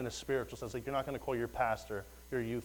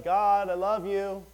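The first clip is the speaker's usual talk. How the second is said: a slow, higher, louder cry to God, held; heartfelt prayer; 7 semitones higher, 8 dB louder, slower than usual. Retold or imitated. A worker calling out to God at work, slow and heartfelt. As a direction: call out love to God, drawn out. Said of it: "just like".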